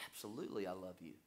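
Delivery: very soft, low declaration; softly voiced profession of love; hushed acted answer. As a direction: say it low and quietly, intimate. Peter answers Jesus tenderly, acted.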